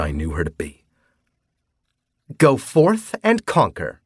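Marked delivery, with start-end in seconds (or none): 2.4-4.0 high, slow, sweetly menacing; bloodthirsty cutesy voice. A narrator's reading. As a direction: relish it with a wide grin. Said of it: "smiling wide like the bloodthirsty bitch".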